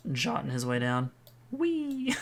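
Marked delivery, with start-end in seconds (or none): 1.5-2.2 gleeful whee. Pretend. Axel zooming down, playful cheer.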